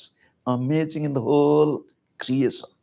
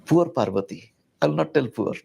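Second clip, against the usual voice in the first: usual speaking voice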